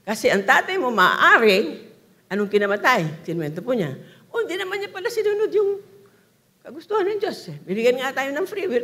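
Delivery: loud preaching, each phrase pushed out hard; full-force preaching. Acted. Preacher challenges the congregation, loud and forceful. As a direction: drive the question home to the crowd.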